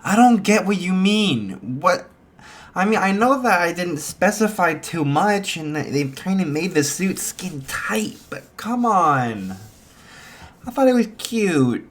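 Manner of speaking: high, put-on girlish voice; girl defending her cute suit, innocently. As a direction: play the girl clueless and sweet. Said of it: "she's like".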